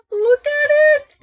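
very high, loud squeal of delight; excited squeal. Real, not acted. Vlogger showing off the queen crown, excited squeal. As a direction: squeal it, far up in pitch.

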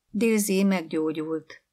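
ordinary delivery, nothing marked

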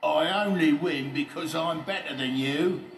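loud, high boastful shout; taunting call across the yard. A narrator's reading. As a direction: shout the brag back at them. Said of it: "called out Oliver Overdrive".

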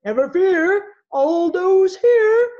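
loud, high, slow booming call; roaring. Acted. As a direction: roar it out like a hero arriving. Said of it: "voice roared".

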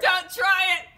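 DONT yelled loud and high; hammed-up warning shout. Host dubbing the duel, with a loud hammed-up warning. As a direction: bark the warning hard. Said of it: "doing battle".

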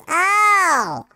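ordinary delivery

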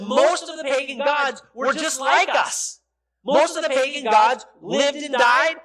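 loud, driving preacher voice; fervent preaching. Acted. Preacher warning the congregation about pagan gods, fervent. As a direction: hammer each line home.

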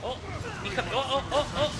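high, loud alarmed ohs; panicked cries. Real, not acted. Gamer about to be crushed by hydra, panicked high cries. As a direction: yelp as it crushes you.

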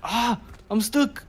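sudden high cry of alarm; startled yelp mid-fight. Real, not acted. Stuck during a fight.

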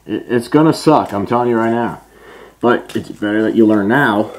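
muffled behind rubber facepiece; through a gas mask. Real, not acted. Seen 4: Full gas mask covers mouth and nose throughout; removed at 24.2.

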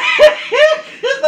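high burst of loud laughter. Real, not acted. Friends reacting to pepper eating.

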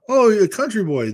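quoting people, high-pitched; imitating what folks say when they meet him; a hint of a teasing outsider; mock country-boy greeting. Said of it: "do I sound like most people when they think".

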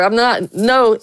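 ordinary delivery

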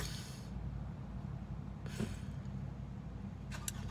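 silent teary sob breaking out; choked sob. Real, not acted. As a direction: let a quiet cry escape. Tearing up over past year.

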